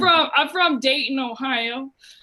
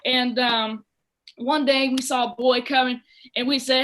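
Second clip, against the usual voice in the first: usual speaking voice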